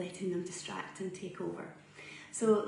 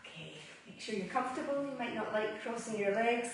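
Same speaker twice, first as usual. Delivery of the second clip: soft, unhurried yoga breathing cues with long pauses; guided meditation; 9 dB quieter than usual. Acted. Yoga teacher guiding students through Ujjayi breath, soft meditation voice.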